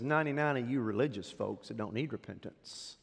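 usual speaking voice

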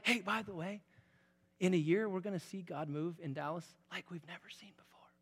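soft, hushed promise to the people; whispered promise. Acted. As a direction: breathe the news like a secret. Imagined whispered announcement.

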